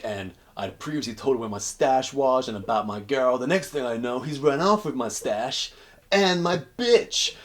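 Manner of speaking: a betrayed con working himself up; aggrieved prisoner. Retold or imitated. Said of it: "And he's like".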